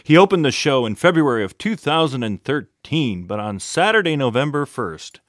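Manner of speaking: upbeat, punchy announcer read; radio show introduction. Real, not acted. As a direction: sell the show like a radio host. Host's scripted show introduction.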